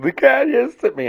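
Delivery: high mimicked voice; imitated complaint. Brianna telling him about the cat, high-pitched imitated complaint. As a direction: whine it up high, mocking her.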